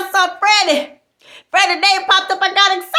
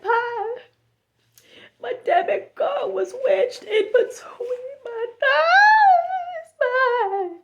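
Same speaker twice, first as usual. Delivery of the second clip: higher, slow, sobbing wail; hammed-up crying confession; mock wailing; 5 semitones higher, much slower than usual. Friend confessing the card was between her thighs, wailing.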